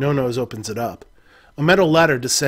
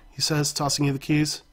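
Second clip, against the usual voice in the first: ordinary talk, nothing marked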